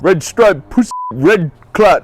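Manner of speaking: lightly done Jamaican patois; Jamaican accent. Pretend. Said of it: "you can do Jamaican accent".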